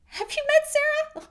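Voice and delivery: playful mock disbelief; playful sarcasm. Shown as a demonstration. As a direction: tease a friend for a silly question. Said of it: "It can just be kind of funny".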